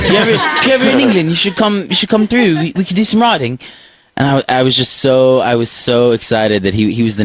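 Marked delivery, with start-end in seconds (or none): 0.0-3.6 put-on faster, lightly laughing English accent; a light imitation of a London singer, through a laugh. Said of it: "but he goes".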